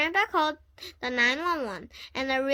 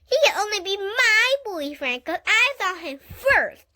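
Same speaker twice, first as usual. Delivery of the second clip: high, louder possessive scolding; jealous insistence; 6 semitones higher, 5 dB louder than usual. A narrator's reading. Lucille insisting Warren is her boyfriend, higher and louder. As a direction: claim him, he's yours.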